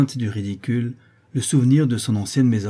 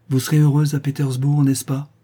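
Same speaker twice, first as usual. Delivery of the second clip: higher, louder, pained question; anguished heartbreak; 5 semitones higher, 6 dB louder than usual. A narrator's reading. Rejected prince parting from the girl, mildly anguished. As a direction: ask it with a touch of heartbreak. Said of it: "avec une singulière expression de déchirement".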